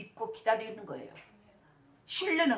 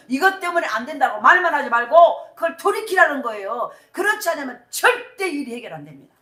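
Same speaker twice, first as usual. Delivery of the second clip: high, loud, emphatic sermon delivery; forceful preaching; 5 semitones higher, 10 dB louder than usual. Real, not acted. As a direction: drive the point home loudly to the congregation. (힘주어 외치는 설교조)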